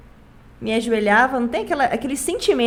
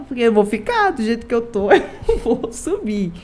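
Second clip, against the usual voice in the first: said through a laugh. Real, not acted. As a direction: chuckle through the admission. Laughs at her own realization.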